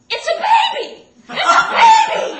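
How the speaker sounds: full-throated high-pitched scream. Retold or imitated. New mother in delivery room looking down at the newborn, screaming. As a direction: scream it out in shock, very loud and high. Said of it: "and I scream".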